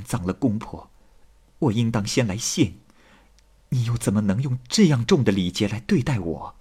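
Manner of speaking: soft, tender, measured speech; heartfelt gratitude. Acted. Wife holding Tang's hand to thank her, soft and heartfelt. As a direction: speak gently, as if confiding in a new sister.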